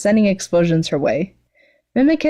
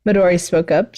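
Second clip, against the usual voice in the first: plain reading voice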